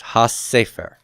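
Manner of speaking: "ha sefer" with a long double s; ha sefer run together as has-sefer; doubled consonant. Shown as a demonstration. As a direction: hold the s joining ha to sefer. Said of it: "add the Dagesh forte".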